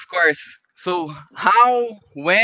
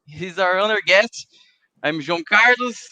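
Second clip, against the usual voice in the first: usual speaking voice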